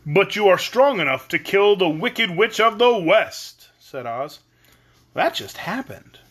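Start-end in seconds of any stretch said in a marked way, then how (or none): 0.1-3.7 loud, higher, booming wizard; commanding great head. A narrator's reading. Great head telling girl she is strong, forceful. Oz head's voice in story. 5.1-6.2 put-on slow, higher girl's reply; simply. A narrator's reading. Dorothy excusing herself to Oz, simple. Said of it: "returned Dorothy simply".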